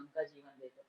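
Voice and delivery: उनका जीवन देखो dropped to a near murmur; hushed repeat of उनका जीवन देखो; softly repeated. Real, not acted. Repeated exhortation, dropped low.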